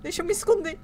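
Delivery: loud, high cry of alarm; panicked. Real, not acted. Player fleeing to hide after a scare, panicked cry. Just screamed, wants to hide.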